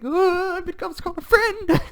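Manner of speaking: very high, loud, tearful-sounding squeal; hammed-up overjoyed wail. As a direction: wail it like an overwhelmed cartoon friend. Acts out overjoyed friend.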